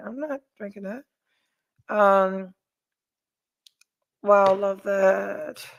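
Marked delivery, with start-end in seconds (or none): 0.1-1.0 soft, understated retold refusal; quiet refusal. Woman retelling how she turned down a drink, quiet refusal. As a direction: drop the volume right down to decline. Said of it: "I was like".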